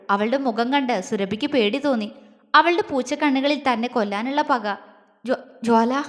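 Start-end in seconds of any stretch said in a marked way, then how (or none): 5.3-6.0 faltering, fearful call. A narrator's reading. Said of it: "പേടിയോടെ വിളിച്ചു".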